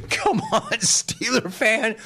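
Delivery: high, laughing jab at the fans; laughing tease. Real, not acted. Host laughing at upset Steeler fans, teasing. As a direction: needle them through a laugh.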